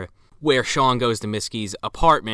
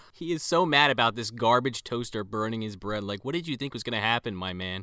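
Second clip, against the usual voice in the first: usual speaking voice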